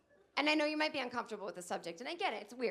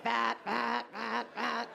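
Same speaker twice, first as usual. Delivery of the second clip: mock alarm beep; 4 dB louder, much slower than usual. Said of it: "like, it's judging you".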